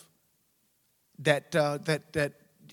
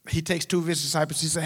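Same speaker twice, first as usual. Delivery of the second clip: usual speaking voice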